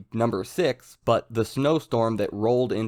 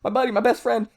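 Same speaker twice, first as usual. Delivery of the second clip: frantic, much higher and louder babble; freaking out; 13 semitones higher, 5 dB louder, faster than usual. Retold or imitated. Furuhashi freaking out as his buddy wakes up, frantic high babble. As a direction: gush over your buddy in a panic. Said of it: "Furuhashi's there freaking out like".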